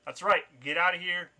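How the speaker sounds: triumphant warning called out loud; shout. Acted. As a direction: shout after fleeing attackers, acted. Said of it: "shout, like".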